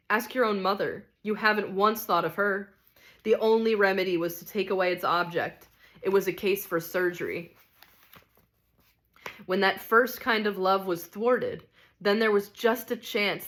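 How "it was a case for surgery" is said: ordinary delivery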